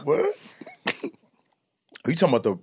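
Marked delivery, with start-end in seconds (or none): 0.0-0.4 stunned what. Retold or imitated. Shocked at heckler.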